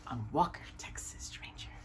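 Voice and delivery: faint whispered afterthought; whispered aside. Real, not acted. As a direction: drop to a whisper on the name. Trailing aside about TV show.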